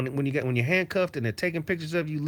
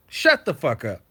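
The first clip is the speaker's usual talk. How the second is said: usual speaking voice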